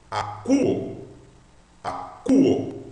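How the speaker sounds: "A, cu, o" in separate syllables, accent on "cu"; stress on CU. Shown as a demonstration. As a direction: model "A, cu, o" with "cu" as the strong U syllable. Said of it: "Sílaba tônica é cu".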